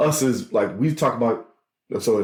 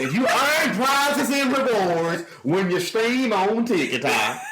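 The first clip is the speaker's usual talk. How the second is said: mock streamer voice; 10 semitones higher, slower than usual. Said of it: "Why do you sound like that?".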